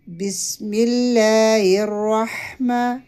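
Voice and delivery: ما in الرحمن held one alif long; slow recitation with long ما; lengthened ma. Shown as a demonstration. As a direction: stretch the ما of الرحمن. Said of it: "اتنا لمبا کریں گے اس کو".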